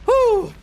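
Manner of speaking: sharp high whew from the burn; pained burnt-tongue whew. Real, not acted. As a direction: blow out the heat, high pitched. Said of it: "Burnt my tongue".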